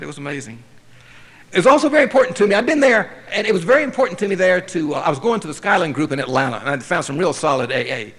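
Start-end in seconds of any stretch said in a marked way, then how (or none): 1.5-4.6 higher, louder and fast; emphatic. Real, not acted. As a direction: press it home with feeling. Emphatic recollection.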